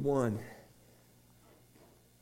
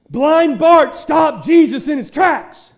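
preached shout; 12 semitones higher, 15 dB louder, slower than usual. Acted. Preached climax.

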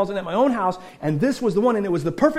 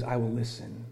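hushed divine promise; 10 semitones lower, 13 dB quieter than usual. Acted. God's words, very soft.

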